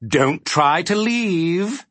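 high, slow villain warning; ominous, drawn-out demon voice; menacing order. A narrator's reading. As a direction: stretch the command out threateningly. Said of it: "he ordered with a menacing".

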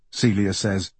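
ordinary delivery, nothing marked

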